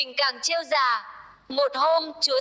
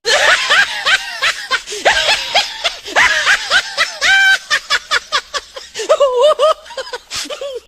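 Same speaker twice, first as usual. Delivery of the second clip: loud, high burst of laughter; big real laugh; hearty laugh; 10 dB louder than usual. Real, not acted. Laugh after story ends.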